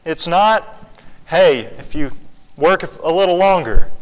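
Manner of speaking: rising sermon fervor; preaching at full force. Acted. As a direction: plead with the hypocrites to come in. Preaching climax to Pharisees.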